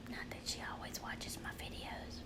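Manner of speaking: hushed secret-keeping whisper. Real, not acted. Hiding a gift plan from her daughter in a whisper. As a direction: whisper it so she won't hear. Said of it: "And I'm not sure why I'm whispering".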